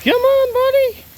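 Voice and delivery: very high, loud call to the dog. Real, not acted. Calling dog excitedly.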